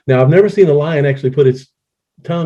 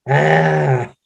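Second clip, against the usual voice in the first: roar attempt. Shown as a demonstration. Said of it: "You can't make the R sounds".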